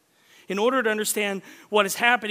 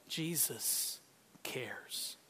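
whisper; 7 semitones lower, 14 dB quieter, much slower than usual. Real, not acted. Sermon point whispered.

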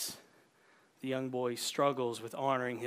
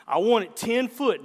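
louder, higher insistence on ten; emphatic old man; 7 semitones higher, 9 dB louder than usual. Acted. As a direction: hit want it ten foot hard. Old man stresses the depth.

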